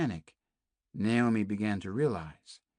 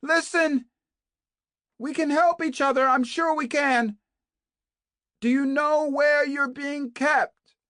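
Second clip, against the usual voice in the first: much higher and louder, urgent calling; 9 dB louder than usual. A narrator's reading. Kate calls to Naomi through the walls, urgent shout. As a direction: call out through the wall, urgent. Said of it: "called out again".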